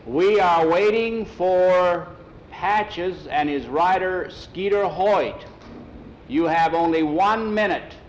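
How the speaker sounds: slow, high, drawn-out call; booming-out racetrack summons; megaphone announcer. Acted. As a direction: stretch each word over an impatient crowd. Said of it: "The man on the megaphone".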